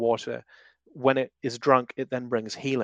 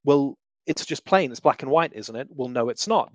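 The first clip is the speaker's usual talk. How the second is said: usual speaking voice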